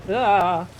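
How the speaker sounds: ordinary talk, nothing marked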